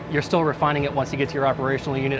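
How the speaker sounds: ordinary talk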